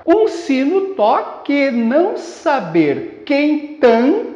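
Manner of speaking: slow, measured pulse, stopping on "tan"; syllable-by-syllable scansion. Shown as a demonstration. Teacher scanning a verse, syllable by syllable. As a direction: chant out each syllable slowly and evenly. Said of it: "Fazendo a escansão, você teria isto aqui".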